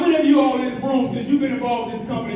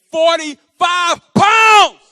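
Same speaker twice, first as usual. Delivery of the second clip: triumphant shout; 7 semitones higher, 12 dB louder, much slower than usual. Real, not acted. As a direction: build to a slow, huge shout. Climax of weight-loss testimony.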